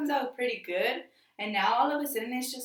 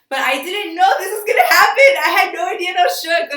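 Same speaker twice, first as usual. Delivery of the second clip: excited; 6 semitones higher, 12 dB louder than usual. Real, not acted. Building up the story's shock.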